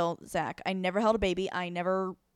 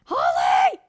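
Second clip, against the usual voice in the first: louder, frantic shout for Holly; panicked calling out; 6 dB louder, slower than usual. Retold or imitated. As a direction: yell for help as if the baby is slipping. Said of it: "panic attack right there and been like".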